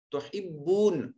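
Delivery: "تحبون" slowly, with the final oo drawn out; lengthened vowel. Shown as a demonstration. Teacher explaining madd to students, "تحبون" with a lengthened oo. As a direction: stretch the long waw in "تحبون". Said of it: "اس کو لمبا کر کے پڑھیں گے".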